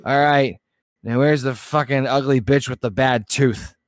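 put-on loud, slurring drunk yelling; drunk shout. Hammered bar patron shouting for the wife, loud drunk yell.

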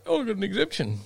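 high-pitched mock protest; objecting participant. Participant dodging the face mask rule, high mock protest. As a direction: put on a high, complaining excuse-maker voice. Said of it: "And they're like".

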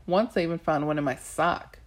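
ordinary delivery, nothing marked

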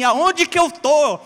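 loud, high, slow yell of exasperation; frustrated yell. Retold or imitated. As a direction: bellow it while slapping the wheel. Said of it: "você dá tapa no volante e fala assim".

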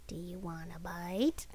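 put-on soft, slow cutesy baby voice; baby talk voice. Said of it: "like, a baby, you know, talk thing".

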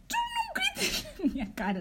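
very high-pitched retold squawk, breaking into laughter; high, indignant re-enacted warning; outraged retort. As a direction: shriek back at him in mock outrage. Ele estava a gritar na minha cara.

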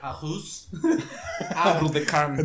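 Catalan dish name said the French way; French accent. Acted. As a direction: say "Arroz am Bru de Carne" with a French accent and a laugh. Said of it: "yo te lo voy a decir como es".

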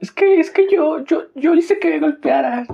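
mock battered woman. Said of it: "el güey que les pega, y lo quieren defender".

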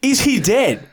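high, urgent question; retold alarmed question. Friend to the girlfriend on the phone, alarmed. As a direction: re-enact blurting it out in alarm. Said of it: "I said to her".

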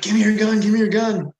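high, loud re-enacted yell to the guide; urgent yell. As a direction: act out calling urgently for it. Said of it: "I, like, yelled to the guide".

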